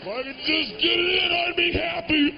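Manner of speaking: high, loud pleading cry; hammed-up longing shout; mock yearning shout. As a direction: yell it like a desperate wish. Comedian acting out masturbation yearning.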